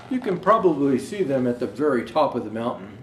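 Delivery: ordinary delivery, nothing marked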